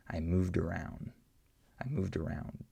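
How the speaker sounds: "I moved around" with the d running into "around"; linked d, "moved around"; d linking to vowel. Shown as a demonstration. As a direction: carry the d straight into the vowel. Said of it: "the D links to the next vowel".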